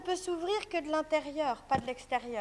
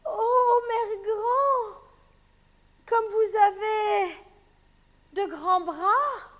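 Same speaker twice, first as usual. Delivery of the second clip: higher, slow, wondering; girl amazed; 5 semitones higher, 5 dB louder, much slower than usual. Acted. Girl amazed at grandmother's arms. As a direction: marvel at the arms.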